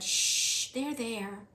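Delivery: calm her gently; soothing. Acted. Louise soothing the weeping cook.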